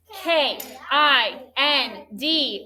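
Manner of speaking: slow, even letter-by-letter spelling; spelled letter by letter. Shown as a demonstration. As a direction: call out each letter slowly for the class to join. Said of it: "let's all spell our base word".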